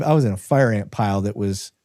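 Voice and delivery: ordinary talk, nothing marked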